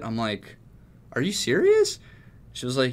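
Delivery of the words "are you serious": incredulous. Retold or imitated. Incredulous reply to manager.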